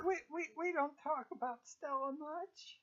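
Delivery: hushed, stammering unease; nervous servant on a forbidden topic; evasive timid cook. Acted. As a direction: softly dodge the subject, voice rising. Cook evades question about Stella.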